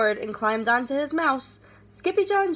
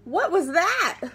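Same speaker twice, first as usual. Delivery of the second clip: startled question; 6 semitones higher, 3 dB louder, slower than usual. Acted. Kitten startled by mirror.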